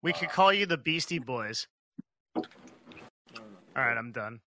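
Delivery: usual speaking voice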